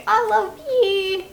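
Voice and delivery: very high sweet cutie voice; lovey-dovey cutie. Shown as a demonstration. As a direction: gush it up high and cute. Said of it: "all cutie".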